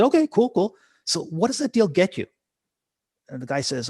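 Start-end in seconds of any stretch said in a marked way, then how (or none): 0.0-2.3 much higher, louder, breezy coach voice; upbeat Robbins. Retold or imitated. Tony Robbins cheerfully humoring the guy on stage. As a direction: toss it off brightly like a showman.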